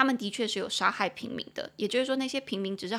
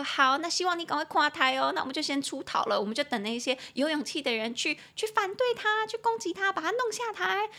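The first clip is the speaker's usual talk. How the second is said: high-pitched imitation of armchair critics; mock cheerleading; 7 semitones higher than usual. Teacher mimicking people who leave resistance to others, mock urging, rising high. Acting out those who only talk.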